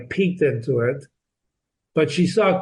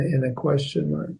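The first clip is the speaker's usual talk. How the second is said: ordinary delivery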